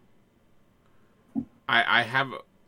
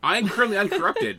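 laughing; 7 semitones higher, 6 dB louder than usual. Real, not acted. Joking protest with laughter.

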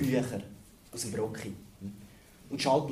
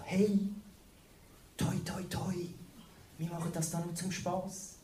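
cheery hipster tourist; 4 semitones higher than usual. Retold or imitated. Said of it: "haben sie gemacht, so".

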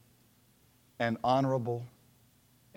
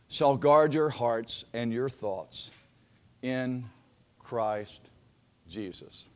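solemn scripture reading; 3 semitones lower, 4 dB louder than usual. Acted. Preacher reading Philippians.

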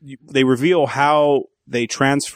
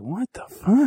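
mock bewilderment; 9 semitones higher, slower than usual. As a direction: voice leaping up on a retold double take. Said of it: "Elijah Wood's like".

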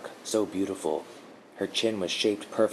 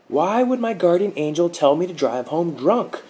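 high, loud furious yell; much higher, louder yell at his guardian; 11 semitones higher, 8 dB louder than usual. A narrator's reading. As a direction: yell it at her, outraged. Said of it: "Mark yelled".

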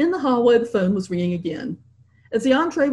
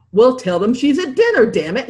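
irritated, louder outburst; blasting; 5 semitones higher, 5 dB louder than usual. A narrator's reading. Husband snapping at the butler over dinner, loud and irritated. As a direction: blast it out at the interruption. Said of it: "Henry blasted".

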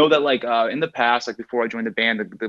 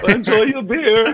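high, loud, slow sneer with a laugh; mocking send-off; 12 semitones higher, 5 dB louder, slower than usual. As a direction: laugh it out as a smug officer. Mock customs officer send-off.

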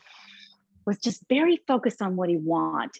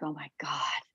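whispered; 5 semitones lower, 9 dB quieter than usual. Retold or imitated. Dread before the shoot.

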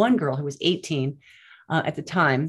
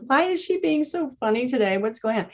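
high-pitched imitation of baffled kids; puzzled kids; 8 semitones higher than usual. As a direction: mimic curious children asking one another. Voices the puzzled kids asking.

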